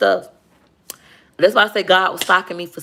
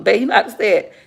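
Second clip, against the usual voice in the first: ordinary delivery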